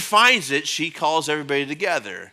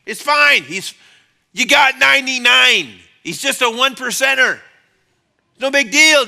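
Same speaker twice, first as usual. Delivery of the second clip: dismissive; 7 semitones higher, 7 dB louder than usual. Retold or imitated. Voicing a dismissive speaker.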